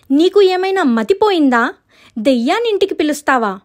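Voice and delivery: high, scandalized question; shocked little-sister outburst; acted incredulity.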